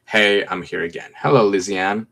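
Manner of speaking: usual speaking voice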